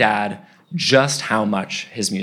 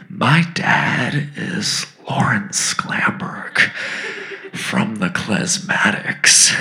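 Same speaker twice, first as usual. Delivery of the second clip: slow, whispered big reveal; dramatic whisper; much slower than usual. Retold or imitated. Kid drops dad's name to his idol, slow dramatic whisper. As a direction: whisper it slowly like a momentous secret.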